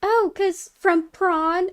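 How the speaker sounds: put-on slow, high innocent girl voice; mock innocent stepsister; imitating the stepsister. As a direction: draw it out innocently. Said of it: "she's like".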